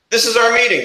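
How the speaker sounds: a hint of Brooklyn tough guy, starts high; lightly put-on Brooklyn announcer; mock Brooklyn accent. Mock announcer tag, Brooklyn echo.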